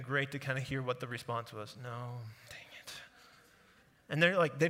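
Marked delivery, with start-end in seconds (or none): 1.8-2.9 breathy whispered disappointment, slower; whispered grumble. Retold or imitated. Preacher voicing the disciples' empty-net answer in a whisper. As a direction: whisper it like a tired fisherman. Imagined reply of the fishermen.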